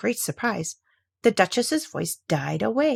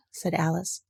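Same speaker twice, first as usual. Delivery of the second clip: usual speaking voice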